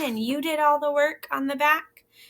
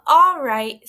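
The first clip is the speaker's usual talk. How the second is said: sighing; 3 semitones higher, 9 dB louder than usual. Acted. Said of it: "sighed the hen".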